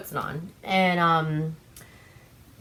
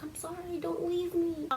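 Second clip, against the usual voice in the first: high, soft pleading voice; mock pleading; 10 semitones higher, 9 dB quieter than usual. Killer begging the upset woman to stay, high mock plea. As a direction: beg softly like a needy man.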